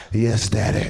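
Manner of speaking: a low, submissive sigh of yes daddy; breathy roommate. Acted. Choked roommate mock-answers the comic in a low voice. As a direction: give in, lightly seductive.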